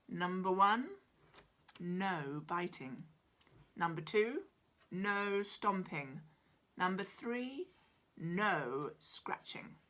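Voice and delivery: firm rules. Acted. Doctor lays down rules.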